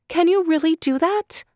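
put-on a girl daring to hope; hopeful surprise. Collier asks the frog if it's true, high with surprise.

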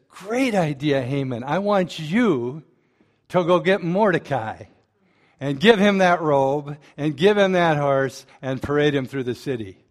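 king's loud command. Retold or imitated. King ordering Haman, loud and emphatic. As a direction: lay down the order with relish.

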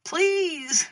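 high, drawn-out plea; acted cry for help; desperate cry. A narrator's reading. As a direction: beg loudly to be rescued. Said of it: "the guard cried out".

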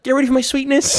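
high put-on voice for "Get ready for my sweetness"; commercial catchphrase. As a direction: sing it out like the Skittles ad. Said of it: "I was like".

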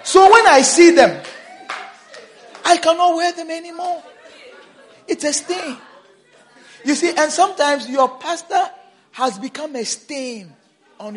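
loud, high, fast preaching; full-force preaching. Acted. Pastor telling about stained shirts, loud and high.

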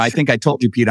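ordinary talk

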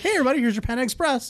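high, fast, carefree imitation of the assistant; breezy cheery mimic. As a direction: toss it off cheerfully, oblivious.

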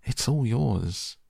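slow, high pleading; begging. Acted. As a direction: beg them slowly to take it. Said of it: "begged Charlie".

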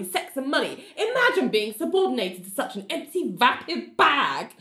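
high, whiny mock complaint, laughing through it; petulant John. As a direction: squeal it like an aggrieved child who always comes second. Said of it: "John pipes up and is like".

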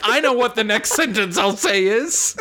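high, laughing mock of friend; laughing imitation. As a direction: imitate her through laughter. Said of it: "You're like".

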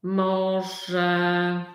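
ordinary talk, nothing marked

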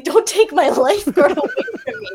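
half-laughing indignant protest; laughing exasperation. Retold or imitated. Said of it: "In my head, I'm like".

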